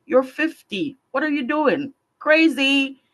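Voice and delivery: lightly mock the voice of polite society; mock scolding. Mimicking society shaming her, lightly mocking scold.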